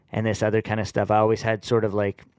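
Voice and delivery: normal talking voice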